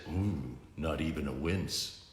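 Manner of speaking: low, soft, dry hero voice; deadpan gravel tease; Batman's low taunt. Acted. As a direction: hero mocking a villain quietly.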